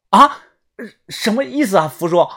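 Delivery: 高声脱口而出地追问; 愕然抬高音调. Acted. 听到警告吃惊追问. (惊讶不解地问)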